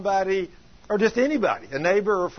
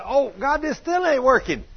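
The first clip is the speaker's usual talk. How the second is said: raised, louder mock plea; frustrated complaint; 6 semitones higher, 4 dB louder than usual. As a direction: imitate an exasperated cry to God. Retelling man's frustrated cry to God.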